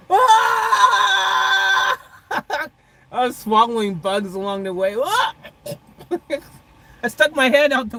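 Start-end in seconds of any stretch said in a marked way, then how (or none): 0.1-2.0 high, loud, full-throated scream; tunnel scream. Retold or imitated. Screaming in the car down the tunnel, high loud yell. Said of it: "I was like". 5.0-5.3 high, loud, full-throated scream; tunnel scream. Retold or imitated. Screaming in the car down the tunnel, high loud yell. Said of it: "I was screaming in the tunnel".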